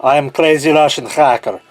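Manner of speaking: hammed-up Russian accent; mock-Russian science show host. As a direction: play a Russian video host in a thick put-on accent. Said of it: "the best of my Russian accent".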